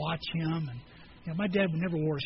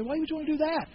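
incredulous question; 8 semitones higher, faster than usual. Retold or imitated. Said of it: "I had people say".

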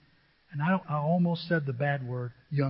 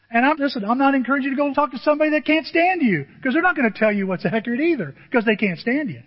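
louder, higher and fast; emphatic preaching; 7 semitones higher, 6 dB louder, faster than usual. Real, not acted. Pastor warning the congregation about bad advisers, louder and fast. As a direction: press the point hard.

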